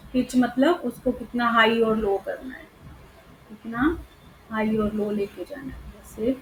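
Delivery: usual speaking voice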